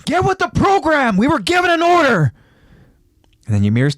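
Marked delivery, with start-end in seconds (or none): 0.0-2.3 very high, strained angry yell; scolding battlefield shout. A narrator's reading. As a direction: yell across at a straggler. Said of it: "Connie yells out to Ymir".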